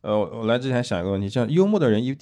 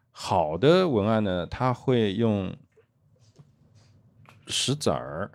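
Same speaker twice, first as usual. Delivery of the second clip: slow suspense build; much slower than usual. Real, not acted. Building suspense before the key image.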